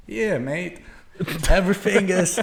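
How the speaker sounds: laughing mock Aussie, slow; mock Australian. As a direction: put on an Aussie twang while laughing. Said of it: "I'm like".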